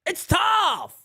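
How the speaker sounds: real fan frustration shout; frustrated shout. Real, not acted. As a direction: vent it like a fed-up fan. Venting about the Yankees.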